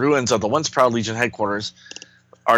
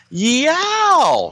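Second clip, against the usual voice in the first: comic yelp of alarm; 4 dB louder than usual. Acted. Comic character cries out in attack.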